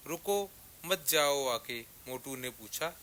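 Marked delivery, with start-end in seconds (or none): none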